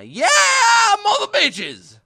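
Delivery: high, very loud, stretched-out whoop; rowdy drawn-out scream; whooping yell. Shown as a demonstration. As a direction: holler it slowly at full volume. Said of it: "I didn't get to, like, toss in a".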